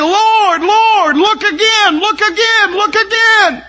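high, loud crying plea to look again; wailing shouted plea; desperate pleading. Acted. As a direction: beg for your life at the throne. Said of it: "they'll say".

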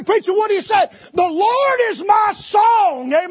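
loud, high preaching shout; shouted call and response. Acted.

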